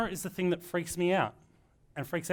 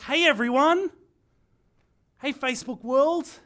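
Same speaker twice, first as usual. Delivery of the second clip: much higher, much louder fake-hype opener; hyped video greeting; 9 semitones higher, 9 dB louder, slower than usual. Shown as a demonstration. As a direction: blast it like a cheesy YouTuber greeting the crowd. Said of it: "this is the one hated thing".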